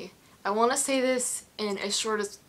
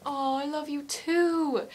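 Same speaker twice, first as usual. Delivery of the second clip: high, drawn-out affectionate reply; imitating a star cooing back at a fan; 6 semitones higher, 3 dB louder, slower than usual. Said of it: "then he went".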